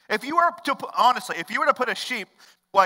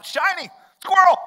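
high, sudden excited cry; loud, high-pitched squeal of delight; distracted excitement; 12 semitones higher, 9 dB louder than usual. Distracted sheep playing out wandering off.

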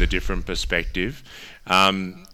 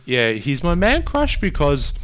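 pitched way up by accident; high squeaky voice; 8 semitones higher, 5 dB louder, slower than usual. Real, not acted. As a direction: voice jumps up high. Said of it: "Your voice".

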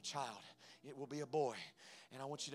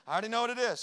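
higher and louder, triumphant certainty; emphatic declaration; 9 semitones higher, 12 dB louder, faster than usual. Retold or imitated. Husband telling his wife he already knows, emphatic and loud. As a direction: announce it like you know it for sure.

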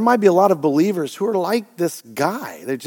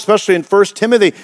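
normal talking voice

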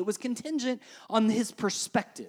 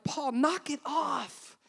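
higher, drawn-out exasperated plea; mock exasperation; 6 semitones higher, much slower than usual. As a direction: imitate fed-up listeners, stretching each word slowly. Imagined protest of Paul's contemporaries.

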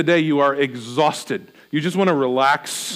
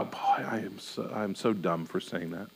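self-reproach; 10 semitones lower, 14 dB quieter than usual. Pretend. Cringing at an old remark, scolding self quietly. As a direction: mutter it under your breath, ashamed. Recalling an old embarrassment inner voice.

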